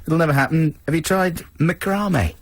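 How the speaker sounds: high, bright jollying-along voice; hammed-up hearty cheer-up; mock jolly consolation. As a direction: chivvy him up cheerily. Crew consoling boy on leaving.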